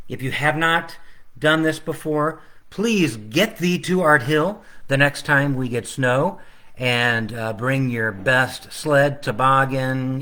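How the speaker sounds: plain reading voice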